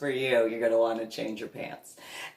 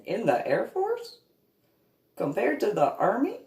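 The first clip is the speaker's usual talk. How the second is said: incredulous; 10 semitones higher than usual. Real, not acted. Incredulous rhetorical questions.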